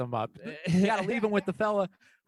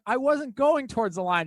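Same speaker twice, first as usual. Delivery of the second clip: higher, mildly defensive mock voice; retold indignant protest; 7 semitones higher, 4 dB louder than usual. Newcomer defending herself to the lady, mock indignation. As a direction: protest it in imitation, pitched up.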